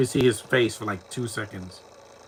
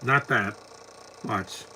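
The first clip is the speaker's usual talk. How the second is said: ordinary delivery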